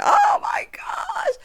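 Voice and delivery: very high, drawn-out cry; hammed-up squeal of heartbreak; mock overwhelmed wail. Acts own reaction to tearful scene.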